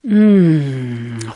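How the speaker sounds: long, low, savouring hum; low hum to oneself; thinking hare. A narrator's reading. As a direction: ponder with a drawn-out mm. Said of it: "thought Hare".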